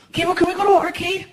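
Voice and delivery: lightly voiced eager kid; kid asking. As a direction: ask eagerly like a boy. Child asks for arcade.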